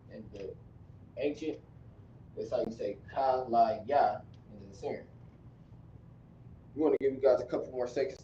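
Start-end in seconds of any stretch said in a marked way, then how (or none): none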